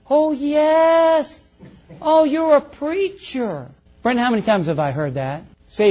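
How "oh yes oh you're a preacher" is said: high, drawn-out "oh yes"; mock gushing reassurance. People insisting the preacher is good, mock gushing. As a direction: gush it higher, exaggerating their protest. Acting out people's reply to him.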